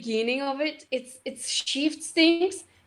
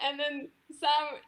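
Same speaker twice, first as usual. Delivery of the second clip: ordinary talk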